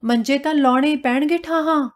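high, urgent challenge; insist, pitching it up to rouse him; calling out insistently. A narrator's reading. Wife urging her husband to move the cots, insistent call. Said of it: "ਲਲਕਾਰ ਕੇ ਆਖਿਆ".

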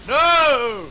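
dramatic cry. Acted. Dramatic death in game.

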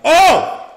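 loud, high "oh" shout; excited yell. Real, not acted. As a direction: yell out mid-battle. Yelling during combat.

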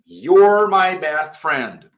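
slow, careful repeat; slow teacher demo. Shown as a demonstration. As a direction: say it slowly again for them. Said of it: "Mesma coisa".

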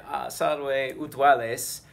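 acted greeting. Shown as a demonstration. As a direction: greet a nervous student. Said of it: "So you can say".

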